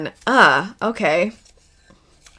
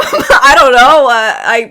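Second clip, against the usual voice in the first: laughing; 5 semitones higher, 11 dB louder, slower than usual. Real, not acted. Laughing at own harem joke.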